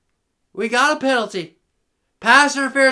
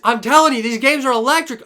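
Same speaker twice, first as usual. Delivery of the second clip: normal talking voice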